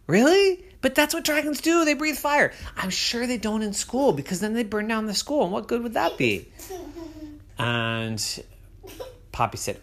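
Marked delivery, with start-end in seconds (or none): 0.1-6.5 put-on high, pleading child; little girl voice. A narrator's reading. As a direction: argue like a small kid. Poppy argues with mom.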